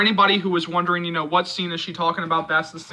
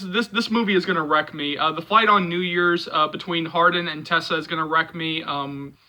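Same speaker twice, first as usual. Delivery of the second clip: ordinary talk, nothing marked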